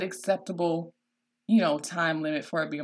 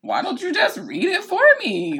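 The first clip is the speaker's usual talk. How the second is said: high, loud mock kid protest; complaining child; 11 semitones higher, 6 dB louder than usual. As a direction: imitate a child wheedling out of reading. Mimics six-year-old balking at schoolwork.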